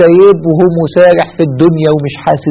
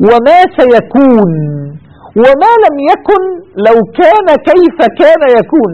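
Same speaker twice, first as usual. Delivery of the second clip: fervent recitation; 8 semitones higher than usual. Real, not acted. Preacher recites God's knowledge.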